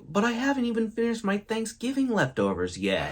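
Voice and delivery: high-pitched, put-upon mock whine; sighing protest. Unready person facing Christmas countdown, high sighing protest. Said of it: "you just let out a sigh, and you're like".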